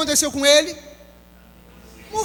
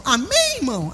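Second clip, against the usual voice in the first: hammed-up pastor fishing for an amen; lightly imitating a Universal Church preacher's sing-song; mock preacher call. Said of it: "todos eles têm a mesma entonação".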